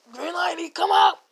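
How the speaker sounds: half-held-back yell; calling out. Acted. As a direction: call the cat out from hiding. Calling the cat to come out.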